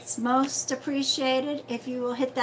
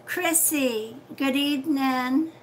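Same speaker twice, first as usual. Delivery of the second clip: usual speaking voice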